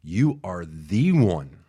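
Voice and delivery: ordinary delivery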